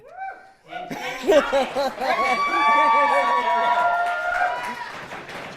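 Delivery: high, loud squealing laugh; delighted shriek laugh. Real, not acted. Big laugh after goal.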